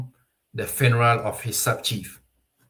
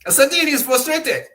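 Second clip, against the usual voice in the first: "Asante Hene is frustrated" said much higher and louder, exasperated; performed frustration; 12 semitones higher, 5 dB louder than usual. Shown as a demonstration. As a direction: throw the whole line up high in exasperation. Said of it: "You see how frustration one can be".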